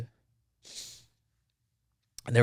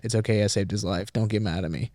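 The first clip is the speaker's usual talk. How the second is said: ordinary delivery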